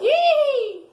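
high, loud startled yelp; surprised yelp. Real, not acted. Sudden surprise in game.

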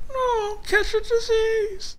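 very high falsetto protest; hammed-up squeaky refusal; squeaky puppet Sheila. Sheila refusing to take off the mask, high squeaky voice.

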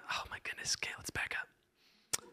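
hushed, voiceless aside; whispered dismay. Real, not acted. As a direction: whisper it under your breath in mock exasperation. Mock dismay at silent congregation.

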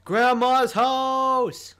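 high, loud, long-drawn call; hollering. Real, not acted. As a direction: call it out slowly across a distance. Calling out to other players.

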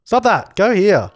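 sharp command. Real, not acted. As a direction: snap at the sim to quit it. Ordering sim to stop.

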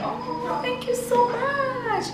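touched, slow thank you so much. Real, not acted. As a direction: melt with gratitude. Moved by birthday letter.

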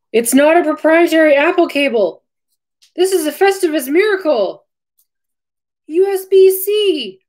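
high, loud delighted squeal; excited. Real, not acted. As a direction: gush with real joy. Thrilled at the USB-C cable.

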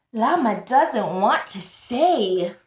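ordinary talk

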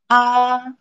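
"A" held high and perfectly level; first tone. Shown as a demonstration. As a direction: sustain "A" on one high, flat note.

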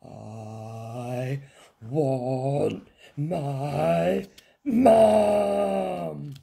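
put-on long, drawn-out child's howl. A narrator's reading. Princess told to eat her eggs, howling. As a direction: build slowly to a loud howled Mum. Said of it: "howled the little princess".

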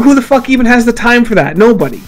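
ordinary talk, nothing marked

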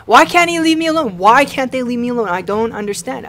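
high, loud shrieking whine; terrified whining plea. Real, not acted. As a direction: plead in desperate panic. Cornered by monster, pleading.

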